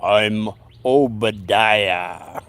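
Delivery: low, very slow and drawn out, like a spirit speaking through her; deep channeled voice. Retold or imitated. The psychic channels Obadiah for the séance guests, deep slow voice. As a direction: let Obadiah rumble through, each word stretched. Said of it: "she comes out with a real deep voice".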